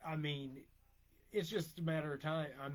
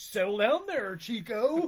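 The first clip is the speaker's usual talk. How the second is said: loud, raised-pitch mock reprimand; mock scolding; 5 semitones higher, 7 dB louder, slower than usual. Host teasing an excited chatter, mock scolding. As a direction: playfully holler it to calm someone down.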